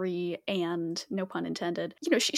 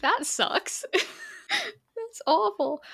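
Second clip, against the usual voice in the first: laughing; 11 semitones higher, much slower than usual. Real, not acted. Reacting to a ship name.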